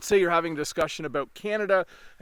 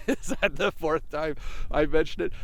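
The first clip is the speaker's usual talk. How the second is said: laughing. Real, not acted.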